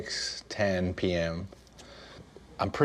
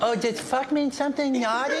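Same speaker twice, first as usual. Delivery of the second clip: very high sweet cartoon voice; cartoon boy pretending innocence; fake-innocent Cartman. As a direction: play dumb like a naughty kid.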